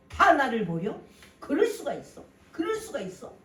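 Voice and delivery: loud, high cry of betrayal; anguished outcry. Retold or imitated. Preacher voicing the abandoned son, crying out loudly.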